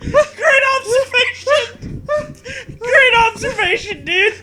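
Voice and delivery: high, slow mock praise, laughing; sarcastic praise. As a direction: fawn over it sarcastically. Mocking sarcastic praise through laughter.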